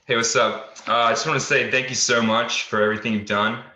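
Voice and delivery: normal talking voice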